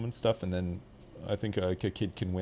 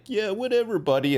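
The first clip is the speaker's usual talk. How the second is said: put-on dismissive kid; 11 semitones higher, 4 dB louder than usual. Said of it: "the kid's like".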